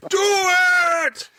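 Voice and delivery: drawn-out, high-pitched shout; hammed-up battle cry; mock motivational yell. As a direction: yell it long and high for laughs. Demonstrating mock motivational screaming.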